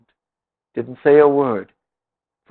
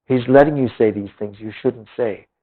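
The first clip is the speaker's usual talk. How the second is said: usual speaking voice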